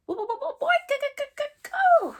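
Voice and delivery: high squeaky stuttering shout; stuttering little dragon. Acted. Toothless protesting to Hiccup, high stuttering shout. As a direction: stammer it out like a tiny dragon. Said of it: "shouted Toothless".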